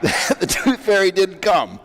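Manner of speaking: outraged daughter imitated; livid child. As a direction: play the furious little girl, hammed-up. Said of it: "like, livid".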